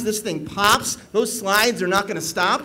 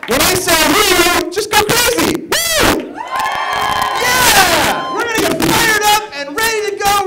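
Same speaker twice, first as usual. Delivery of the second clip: high, loud, whooping hype; fired-up shout; 11 semitones higher, 5 dB louder than usual. Real, not acted. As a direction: whip the crowd into a frenzy. MC firing up the crowd.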